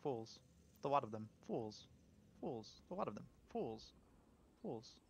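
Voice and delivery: scheming villain mutter. Pretend.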